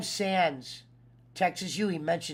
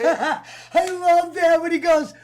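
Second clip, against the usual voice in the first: laughing; 10 semitones higher, 11 dB louder than usual. Real, not acted. Laughing while recalling quote.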